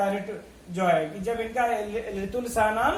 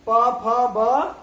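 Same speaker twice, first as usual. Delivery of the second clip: each letter given slowly and a little louder; slow spelled-out recital; 6 dB louder, much slower than usual. Shown as a demonstration. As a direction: spell the letters out one by one for the class. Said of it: "teacher recites letters to class".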